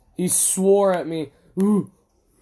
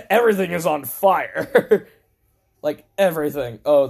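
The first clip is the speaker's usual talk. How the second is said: ordinary talk, nothing marked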